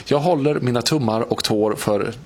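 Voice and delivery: normal talking voice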